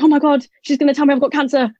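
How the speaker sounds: high-pitched, rushed panic; acting out a panicked realisation; retold freak-out. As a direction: blurt it out in a mock panic. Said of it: "I was, like, freaking out".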